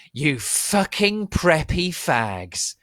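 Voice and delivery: slow, loud, high hammed-up insult; angry shout. Sirius yelling at the preps, slow furious shout. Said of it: "Sirius shouted angrily".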